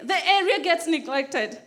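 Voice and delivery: normal talking voice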